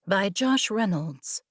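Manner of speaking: usual speaking voice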